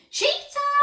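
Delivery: very high, loud scream; high, loud accusing scream. A narrator's reading. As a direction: scream from the sidelines. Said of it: "screamed Moody Margaret's mum".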